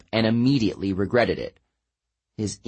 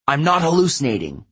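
insisting; 5 semitones higher, 3 dB louder, faster than usual. A narrator's reading. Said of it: "he insisted".

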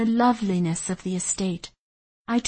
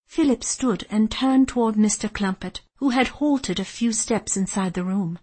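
ordinary delivery

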